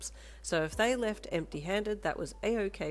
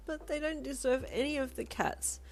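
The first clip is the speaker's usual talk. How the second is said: blurted through sobs; 10 semitones higher than usual. Acted. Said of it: "you blurted out, still sobbing a bit".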